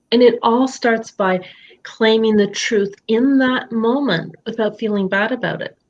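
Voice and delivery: ordinary delivery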